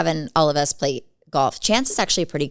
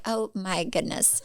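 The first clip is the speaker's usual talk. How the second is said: a slow, soft, exasperated sigh of disbelief; 8 dB quieter, slower than usual. Retold or imitated. Daughter reacts to her mom believing fake videos, soft and slow in exasperation. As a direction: let it drop, quiet and slow, done with it. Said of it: "I'm like".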